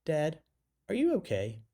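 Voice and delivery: high-pitched imitation of a concerned daughter; put-on high voice, gently checking on dad; innocent child. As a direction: raise the pitch for an innocent little question. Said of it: "innocent eyes and said".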